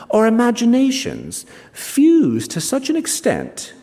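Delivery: a hint of the old friend confiding by the fire; old friend musing. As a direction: lift the voice a touch for the friend's cherished theory. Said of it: "he says".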